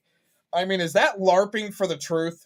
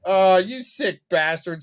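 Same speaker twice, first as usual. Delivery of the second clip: ordinary delivery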